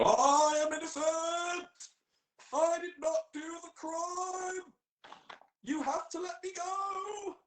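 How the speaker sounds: hammed-up righteous innocence; righteous angry shout. Standing up to the police, a hammed-up slow shout. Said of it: "you wanna stand up and go".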